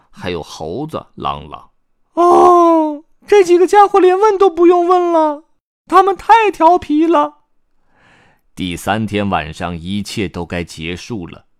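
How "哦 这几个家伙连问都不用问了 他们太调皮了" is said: very high, loud character voice; exasperated story character. Acted. Character judges the naughty bunch, loud high exasperation. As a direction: exclaim it loudly in a high cartoon voice.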